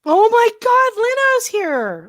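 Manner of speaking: high, loud, slow excited cry with a laugh; excited greeting. Real, not acted. Host thrilled Leno joined the stream, squealing with joy. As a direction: greet a friend with delighted squeal. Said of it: "this is the best day ever".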